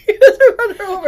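high, loud, helpless laughter in the words; laughing. Real, not acted. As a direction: try to read through the giggles. Failed retake through laughter.

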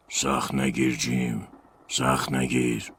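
slow lightly voiced man. Man leaving the drunk friend.

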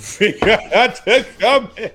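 laughing through the words; words broken by laughter. Real, not acted. Laughing while telling joke.

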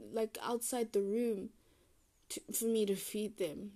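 ordinary delivery

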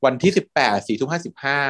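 usual speaking voice

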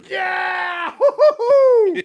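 high whooping church shout; gospel shouting. Retold or imitated.

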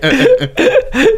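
squealing laugh up high; high burst of laughter. Real, not acted. Laughs at own joke.

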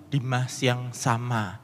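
ordinary talk

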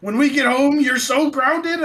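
high, loud, slow stern threat; angry dad threat. Retold or imitated. Father threatens his lost son with grounding, loud and slow. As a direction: drag out the threat to ground him.

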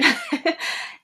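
short high giggle; a little amused laugh; light giggle. Real, not acted. Laughs at the remedy name.

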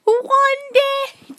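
high, loud squealed correction; incredulous outburst. Real, not acted. Friend correcting how soon he said it, incredulous squeal.

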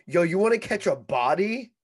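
retold street threat, loud; mock threatening shout. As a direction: call it out like a stranger picking a fight. Acted threat from a stranger.

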